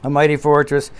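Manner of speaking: usual speaking voice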